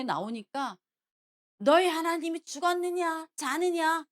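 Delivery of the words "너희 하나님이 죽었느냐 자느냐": loud, high mock challenge; Goliath's bellowed taunt. Goliath taunting Israel's army on the battlefield, bellowing. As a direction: bellow it like a taunting enemy champion. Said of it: "고래고래 소리 지르면서".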